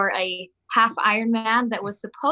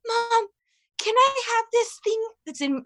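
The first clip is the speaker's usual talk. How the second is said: put-on much higher pleading child voice; little boy asking; 11 semitones higher than usual. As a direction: ask like a kid begging for something from the purse. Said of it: "And he's like".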